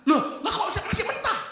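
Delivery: high, startled cry of dismay; a wife gasping at raw rice; shocked wife. Acted.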